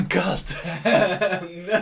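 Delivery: loud, high cry breaking into laughter; laughing protest. Real, not acted. Guesser hearing which monster was revealed, laughing dismay. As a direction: protest through real laughter.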